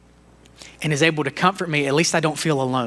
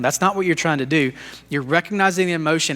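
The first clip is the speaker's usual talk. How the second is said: ordinary talk, nothing marked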